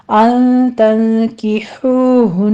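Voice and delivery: very slow chanted tajweed reading; measured Quran recitation, vowels held two counts; slow Quranic recitation. Shown as a demonstration. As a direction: recite it slowly as a model for students. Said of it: "اخفا کی ہو رہا ہے".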